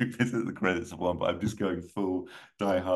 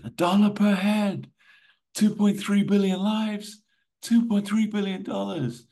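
high, raised, urgent plea; urgent official; 5 dB louder than usual. Acted. Official briefing Poe under chopper blades, high urgent voice. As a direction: push it up high, as if over helicopter blades.

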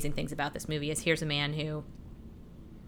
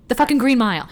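excited; 6 semitones higher, 7 dB louder, faster than usual. Real, not acted. Big reveal to co-host.

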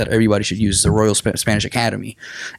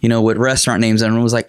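ordinary delivery

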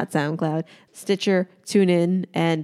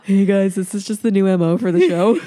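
mock podcast intro; 3 semitones higher, 6 dB louder than usual. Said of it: "We're like".

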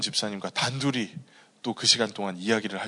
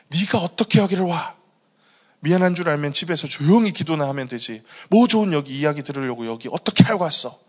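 imitating angry in-laws, higher and louder; angry scolding; 5 semitones higher, 6 dB louder than usual. In-laws confronting the deaconess at the hospital, angry. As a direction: retell their indignant telling-off with force. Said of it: "막 이렇게 화를 내셨고".

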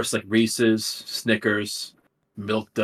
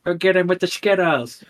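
mock announcer; 8 semitones higher, 5 dB louder than usual. Mock cheer at friend.

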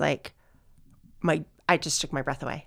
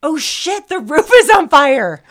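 much higher, much louder, slow panicked cry; alarmed shout; 9 semitones higher, 11 dB louder, slower than usual. Retold or imitated. Husband spotting the fire, shouting in alarm. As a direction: yell the alarm like a startled husband. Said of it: "Julio was like".

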